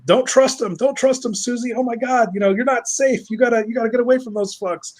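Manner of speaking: high-pitched, mock-panicked pleading; frantic fan warnings. Cam show fans warn Susie to get away, frantic. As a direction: plead urgently in a high, fretful voice.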